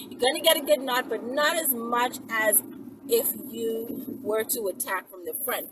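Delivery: usual speaking voice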